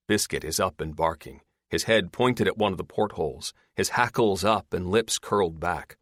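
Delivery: ordinary delivery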